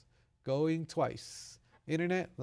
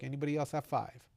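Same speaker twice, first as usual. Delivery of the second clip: usual speaking voice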